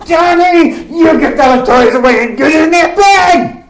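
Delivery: put-on high, loud fed-up shout at a child; the third time, Dad finally loses patience; Dad's stern yell. Dad yelling at Johnny to get to bed, loud and high. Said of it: "by Dad's tone of voice".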